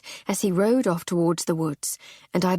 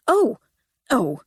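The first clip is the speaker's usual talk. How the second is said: flustered; 4 semitones higher, 4 dB louder than usual. Acted. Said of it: "he said, slightly flustered".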